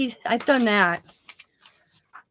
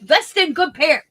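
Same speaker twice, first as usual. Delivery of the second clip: high, loud imitation of his choppy advice; hammed-up old tradesman laying down advice; old German repairman; 6 semitones higher, 6 dB louder than usual. Scissors man advising a customer, imitated old German manner. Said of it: "that's how he talked".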